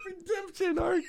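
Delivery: high squeaky words through laughter; real laughter pushing the voice way up; cracking up. Real, not acted. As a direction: echo it while helplessly laughing. Cracking up at co-host's phrase.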